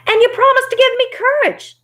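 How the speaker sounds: very high, loud lion voice; insistent Cowardly Lion. Acted. Cowardly Lion claims his promise from Oz, high and loud.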